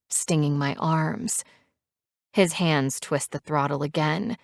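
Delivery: ordinary delivery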